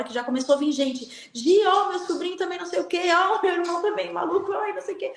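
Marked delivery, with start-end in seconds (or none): 1.3-5.1 higher, rushed, half-laughing chatter; voicing an excited woman. Woman at the shrine pleading about her relatives, excited. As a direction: tumble out the family news in a light put-on voice.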